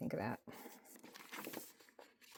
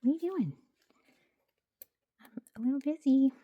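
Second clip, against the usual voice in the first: high, louder pet scolding; scolding the cat; 8 semitones higher, 7 dB louder, faster than usual. Real, not acted. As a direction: tell the cat off playfully, up high. Cat interrupting her.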